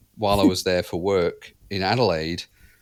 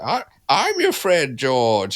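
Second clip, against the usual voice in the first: slow, higher, louder spooky skeleton; 6 semitones higher, 4 dB louder, slower than usual. Retold or imitated. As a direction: creep it out like a grinning skeleton. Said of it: "Do the skeleton voice".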